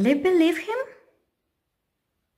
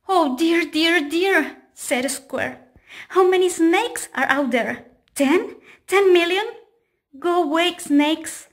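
high, loud fretful panic; frightened square panicking at the door; panicky; 6 semitones higher, 5 dB louder than usual. Acted. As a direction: fret out loud in rising fear. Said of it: "was afraid of snakes".

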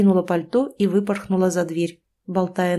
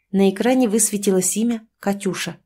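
ordinary delivery, nothing marked

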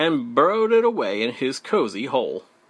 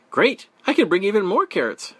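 high, quick, eager rabbit voice; smiling; 7 semitones higher, 5 dB louder, faster than usual. A narrator's reading. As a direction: say it brightly through a smile, rushing along. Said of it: "Rabbit said with a smile".

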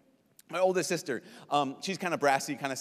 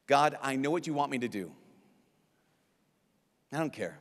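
understated refusal, dropping lower; casual defiance; 3 semitones lower than usual. Acted. Sinner telling God no, casual defiance. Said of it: "I like to define sin is like this".

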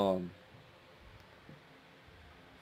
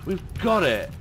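excited; 8 semitones higher, 5 dB louder than usual. Real, not acted. Excited capture shout.

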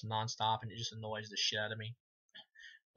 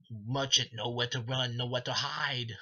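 louder villain taunt, drawn out; mock Pinhead menace; 4 dB louder, slower than usual. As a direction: ham up a movie villain's threat. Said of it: "he's like".

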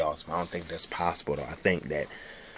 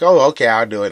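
sarcastic agreement; 4 semitones higher, 12 dB louder than usual. Retold or imitated. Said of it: "I like".

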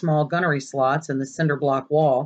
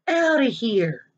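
higher, dismissive fall; sneer; 7 semitones higher, slower than usual. Acted. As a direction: jeer him out the door. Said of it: "sneered Piper".

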